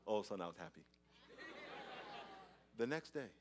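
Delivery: ordinary delivery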